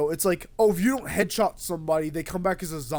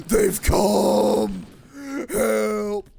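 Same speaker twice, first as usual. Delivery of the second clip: mock panic; 3 semitones higher, 6 dB louder, much slower than usual. Game horde suddenly appears.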